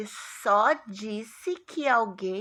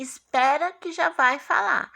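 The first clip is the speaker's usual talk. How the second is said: "Espera que já vai falar" higher and louder; hold-on announcement; 5 semitones higher, 5 dB louder than usual. Shown as a demonstration. Teacher acting out the colon for pupils, announcing. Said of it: "é como se fosse assim".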